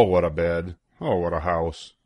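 usual speaking voice

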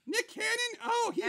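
high put-on diva voice. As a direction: chat up high as the star guest.

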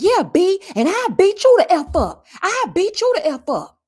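hammed-up fighting words; mock threat. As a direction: Alexis threatening Mika, mock shouting. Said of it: "Alexis jumps up and she's like".

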